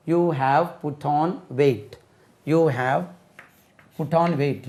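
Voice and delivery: slow, clear model sentence. Shown as a demonstration. Teacher modelling slowly for students to repeat. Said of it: "model sentence".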